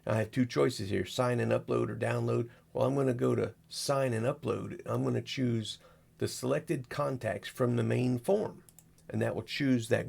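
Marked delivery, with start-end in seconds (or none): none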